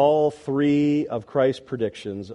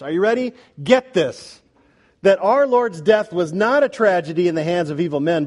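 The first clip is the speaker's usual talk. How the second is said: forceful preaching; 3 semitones higher, 3 dB louder than usual. Real, not acted. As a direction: drive the point home with preacher's fervor.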